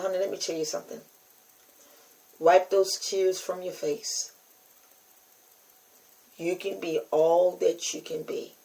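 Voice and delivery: tender. Retold or imitated. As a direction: soothe her gently. Comforting a crying girl.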